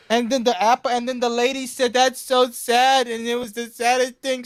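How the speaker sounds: mock him like a second grader recounting his weekend; hammed-up kid-like Trump parody. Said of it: "why does he fucking talk like that".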